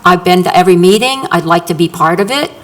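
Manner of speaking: normal talking voice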